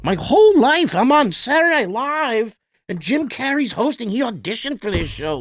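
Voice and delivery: high starstruck disbelief at meeting a hero; starstruck awe. Retold or imitated. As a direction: gush like a starstruck fan. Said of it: "going like".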